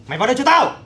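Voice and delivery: high, loud angry command; angry order. Acted. Older brother shouting at his younger brother to come in. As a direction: drag him in with a bark.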